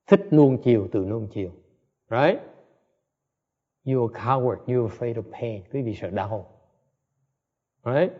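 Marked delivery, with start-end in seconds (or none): none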